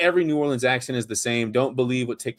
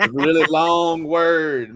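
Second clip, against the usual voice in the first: very slow, drawn-out words; slow Mississippi-style drawl; 3 semitones higher, 6 dB louder, much slower than usual. Shown as a demonstration. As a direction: stretch every word out. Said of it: "You gotta slow down a little bit".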